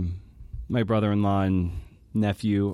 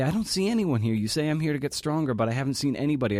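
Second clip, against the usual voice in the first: higher-pitched, quick complaint; imitated protesting mom; 6 semitones higher, faster than usual. Mom insisting no therapist came, imitated protest. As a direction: mimic a confused mother protesting, fast and high.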